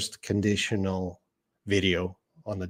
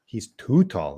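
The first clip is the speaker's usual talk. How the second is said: too stressed as a complaint, falling. Shown as a demonstration. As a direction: lean on too to show a problem. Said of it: "you're saying something's bad".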